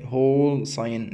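هول ساين stretched out slowly as "hole sign"; slow model of هول ساين; slow demo pronunciation. Shown as a demonstration. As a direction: drill هول ساين for learners. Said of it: "ننطقها كمان مرة اهي".

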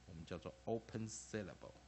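normal talking voice

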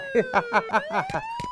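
high gloating cackle; villain cackle. Acted. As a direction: cackle like a cartoon crook. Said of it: "도둑 해피". (높은 악당 웃음)

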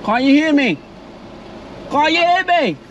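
ordinary talk

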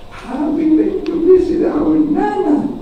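high-pitched small child impression; little-kid voice. Sally telling teacher about vacation, high little-kid voice. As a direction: answer in a put-on high child voice.

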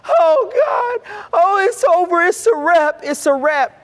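high, loud wailing cry; mock wailing. Mourners wailing over the dead girl, loud crying. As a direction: wail like a mourner at a deathbed.

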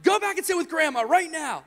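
high, urgent parental command; yelled order. Retold or imitated. Father sends daughter back to Grandma, yelled order. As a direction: order the child off sternly. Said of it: "I'm sorry for yelling".

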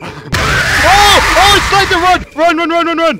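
high shrieking panic, yelling run; panicked screaming. Real, not acted. Fleeing monster in horror game.

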